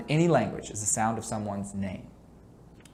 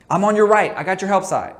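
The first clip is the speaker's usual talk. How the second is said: loud, high court call to a teammate; 5 semitones higher, 7 dB louder than usual. Shown as a demonstration. Coach calling out to a teammate, loud and high. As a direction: call it out loud across the floor. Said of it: "say what you're doing".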